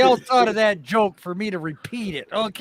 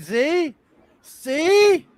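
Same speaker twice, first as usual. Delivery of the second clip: rising, high-pitched "see?"; gleeful gloating; 8 semitones higher, 5 dB louder than usual. Real, not acted. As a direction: crow it higher and louder the second time. Gloats after the joke landed.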